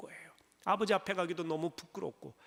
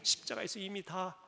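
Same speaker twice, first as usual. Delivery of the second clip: louder, rising preacher's voice; fervent preaching; 4 dB louder than usual. Acted. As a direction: proclaim it from the pulpit. Preaches at full force. (힘차게 외치는 설교)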